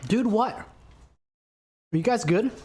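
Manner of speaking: sudden high alarmed question; startled. Real, not acted. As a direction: react startled to a noise.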